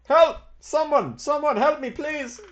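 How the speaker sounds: very high-pitched, panicked pleading; desperate cry for help. Acted. Kidnapped passenger crying out from the carriage, desperate plea for help. Trapped passenger cries for rescue.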